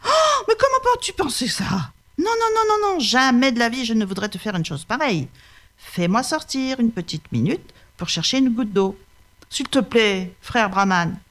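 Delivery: high, wheedling tiger voice; the sly tiger swears he means no harm; hammed-up innocent tiger; protesting tiger.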